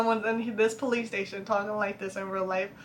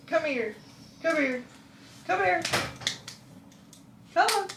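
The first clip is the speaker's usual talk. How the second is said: rising, higher sweet calls to a pet; coaxing pet call; 6 semitones higher, 5 dB quieter than usual. Real, not acted. Breaking off filming to coax her pet over, sweet coaxing calls. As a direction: coax the pet over, pitch climbing each time.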